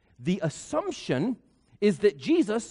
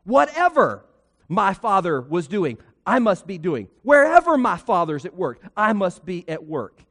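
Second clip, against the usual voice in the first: preaching with force; 5 dB louder than usual. Acted. Preaching the climax.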